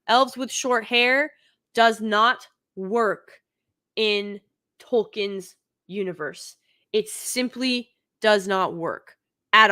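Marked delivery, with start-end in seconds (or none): 0.1-6.6 each word spaced out and stressed; slow, emphatic repeat. Real, not acted. Vlogger repeating her point to viewers, slow and emphatic. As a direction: lay it down slowly, word by word. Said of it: "I'll say it one more time".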